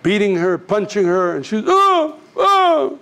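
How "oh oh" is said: high, loud wailed "oh"; imitated crying out. Beaten wife crying out, imitated high wails. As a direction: mimic a beaten woman's cries. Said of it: "crying like that, shouting".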